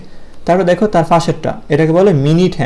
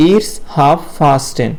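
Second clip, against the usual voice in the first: very slow, word by word it's half past ten; teacher drilling it's half past ten slowly for the class; slow model phrase; much slower than usual. Shown as a demonstration. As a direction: spell it out for young pupils. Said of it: "কি বলবো?".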